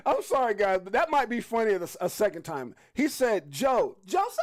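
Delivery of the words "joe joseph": high-pitched imitation. Host mimicking the reporter's yell for the viewers, high-pitched imitation. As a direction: mimic a high squawked Joseph. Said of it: "I can't even get that damn high".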